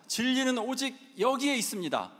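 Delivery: high, ringing proclamation; street preaching shout. Retold or imitated. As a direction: declare it like a street evangelist. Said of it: "하면서 막 외쳐요". (높고 힘차게 외치는 전도 선포)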